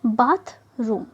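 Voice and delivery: बाथरूम drawn out slowly, syllable by syllable; slow example word. Shown as a demonstration. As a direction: stretch बाथरूम out for the class. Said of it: "जैसे example देखिए". (बाथरूम धीरे-धीरे खींचकर)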